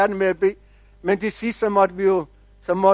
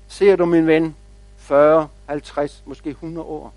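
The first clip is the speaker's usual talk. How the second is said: slow, low wise-elder voice, softening at the end; unhurried, lower voice of a knowing old tree; wise old oak; 4 semitones lower than usual. Acted. Big oak answers the little tree, wise and patient. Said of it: "sagde det store egetræ lidt klog".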